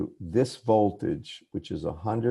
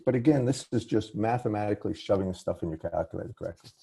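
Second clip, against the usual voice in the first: rushed, quick explanation; running fast; faster than usual. Real, not acted. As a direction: hurry through it in one run. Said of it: "I'm sorry, I'm running fast".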